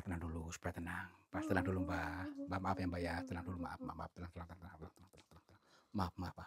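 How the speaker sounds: soft, low calming murmur; soothing. Real, not acted. Calming a frightened old spirit in the cave, soft and soothing. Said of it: "Mari kita mencoba untuk menenangkan".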